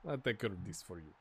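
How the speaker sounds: rough attempt at a Serbian accent; mock Serbian accent, trailing off low and quiet; vague Serbian accent. Manager imitating his player, uncertain Serbian accent. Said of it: "I don't know what that accent is".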